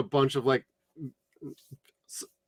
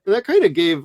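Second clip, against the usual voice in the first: normal talking voice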